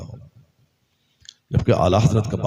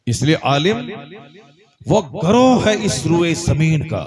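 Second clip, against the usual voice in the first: fiery preaching; 6 semitones higher, 3 dB louder than usual. Acted. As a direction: preach it to the crowd at full force. Preaching climax with Arabic hadith.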